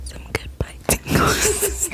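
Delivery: slow whisper breaking into giggles; tingly asmr mouth sounds. Shown as a demonstration. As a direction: drag out the tingles, half laughing. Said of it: "I have some goodbye tingles for you".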